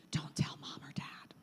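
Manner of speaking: voiceless, hushed plea; secretive whisper. Retold or imitated. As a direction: whisper so no one else hears. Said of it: "But they'll tell you".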